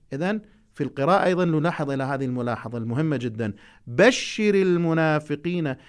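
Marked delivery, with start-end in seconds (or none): 3.9-5.8 بشر slow and firm, short final r; stress on بشر. Shown as a demonstration. Teacher shows the right stress of بشر to students. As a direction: lean on بشر slowly. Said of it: "النبر".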